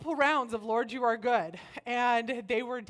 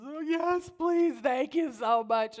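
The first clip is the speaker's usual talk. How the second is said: higher, louder imitation of a grateful friend; gushing gratitude; 5 semitones higher, 5 dB louder, slower than usual. Friend jumping at a free date night, gushing. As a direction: gush the thanks in a raised voice.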